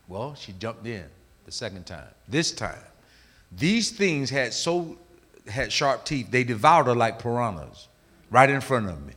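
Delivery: ordinary delivery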